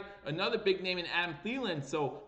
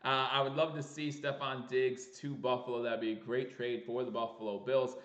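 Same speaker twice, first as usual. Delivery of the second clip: ordinary delivery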